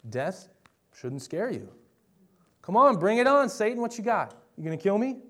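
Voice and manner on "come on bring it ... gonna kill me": much higher and louder, daring Satan to try; mock-challenge the devil, chest out; defiant taunt. Preacher taunts Satan to show faith conquers fear, defiant.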